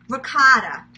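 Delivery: loud New York ricotta, ricotta said as rigotta; Queens accent. Shown as a demonstration. Cooking vlogger shows her Queens accent, loud. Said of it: "I'm from Queens".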